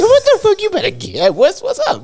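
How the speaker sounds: high-pitched, loud mock alarm; startled retort. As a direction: act out being caught off guard, voice leaping up. Startled retort to menacing roommate.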